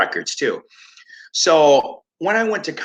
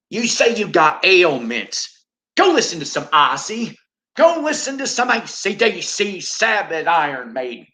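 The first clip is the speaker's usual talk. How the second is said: hammed-up televangelist prescribing bands; mock preacher; 7 semitones higher than usual. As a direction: preach heavy metal as the cure. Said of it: "The Reverend Peace is about to broadcast".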